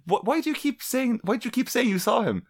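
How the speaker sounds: high, incredulous girlfriend voice, lightly played; mock exasperated girlfriend. Girlfriend's retold quarrel.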